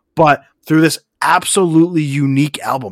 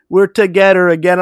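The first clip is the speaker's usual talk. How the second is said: higher, louder mock French Canadian accent; 5 semitones higher, 5 dB louder than usual. Fan imitating the French band he loves, playful French Canadian accent. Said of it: "say it like a French Canadian guy".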